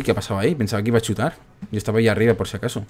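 normal talking voice

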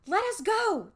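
high, loud frightened plea; panicked cry. Acted. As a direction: shield yourself and cry out. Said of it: "Josh cried".

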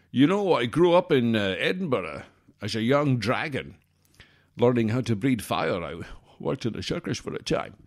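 hammed-up Scottish actor impression; Scottish accent. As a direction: put on a lilting Scottish accent, s turning to sh. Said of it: "with a Scottish accent".

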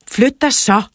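high, loud order; shouting. A narrator's reading. Narrator ordering Tobias away from the door, shouting. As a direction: shout it to make him move. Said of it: "råbte jeg".

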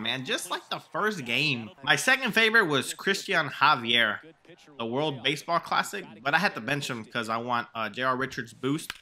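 ordinary talk

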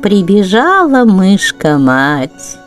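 ordinary delivery, nothing marked

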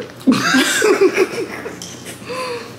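helpless high-pitched giggle; high squealing laugh. Real, not acted. Friend breaks down laughing.

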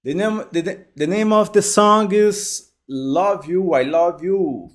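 normal talking voice

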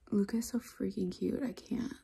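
soft unvoiced whisper. Real, not acted. Whispering about the sleeping dog, hushed. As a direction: whisper so the dog stays asleep.

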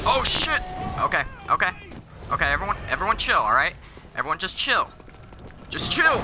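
fast, rising nervous pleading; panicked pleading. Real, not acted. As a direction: beg them to calm down in panic. Surrounded by enemies.

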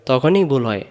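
ordinary delivery

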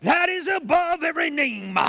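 high, loud, slow hammed-up preacher prayer; mock grandiose prayer. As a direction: overblown holy-roller prayer. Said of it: "He doesn't even add syllables".

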